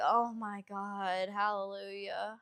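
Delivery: slow drawn-out relief; slow motion. Retold or imitated. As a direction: stretch each word like slow motion. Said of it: "in slow motion like that".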